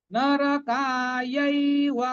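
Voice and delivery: নরকায়ৈব with the ৈ held long; long vowel. Shown as a demonstration. Teacher showing students the long ঐ in নরকায়ৈব. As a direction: stretch the ঐ in নরকায়ৈব. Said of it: "এটা দীর্ঘ হবে".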